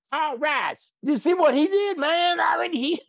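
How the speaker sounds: put-on a Southern accent thrown way up into touchdown excitement; deep Southern accent. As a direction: cousin cheering a touchdown in a thick Southern accent. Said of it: "he'll go way deep in the woods".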